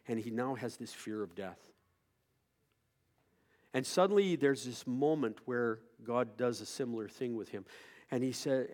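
ordinary talk, nothing marked

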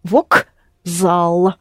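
Vokzal, slow and careful, with a z; careful Russian. Shown as a demonstration. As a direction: spell it out by sound: Vokzal. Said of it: "that's how you spell it".

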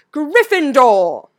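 loud, high, drawn-out shout; Sorting Hat shouting. A narrator's reading. Hat declares the boy's house, shouting it slowly. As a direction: announce it to the whole hall, stretched out. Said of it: "opened again and shouted".

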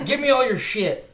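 loud, high-pitched demand; playful stick-up yell; mock robber's shout. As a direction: bark it out as a pretend robber.

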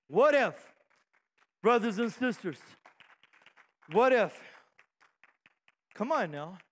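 ordinary talk

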